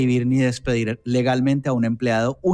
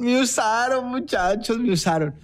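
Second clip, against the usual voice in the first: hammed-up tearful complaint; mock crying; 6 semitones higher than usual. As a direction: imitate a friend wailing to the guys. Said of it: "llega Lucho acá llorando".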